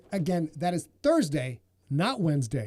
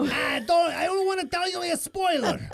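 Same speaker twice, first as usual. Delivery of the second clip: mock outburst; 13 semitones higher than usual. Host playfully refusing to give fans spoilers, mock outburst. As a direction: shout it in exaggerated refusal.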